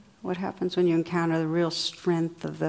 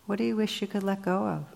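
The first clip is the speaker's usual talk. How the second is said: guided reflection. Acted. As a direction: invite them inward, gently and unhurried. Leads a reflection.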